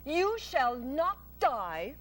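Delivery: slow, calm promise; reassuring spider. Acted. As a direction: comfort the pig.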